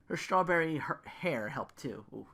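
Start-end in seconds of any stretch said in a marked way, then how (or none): none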